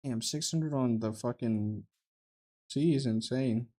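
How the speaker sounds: ordinary talk, nothing marked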